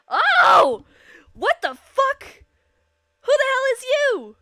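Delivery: loud, high startled cry; shocked outburst. Real, not acted. Streamer startled by surprise guest in chat, loud shock. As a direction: jump out of your seat in shock.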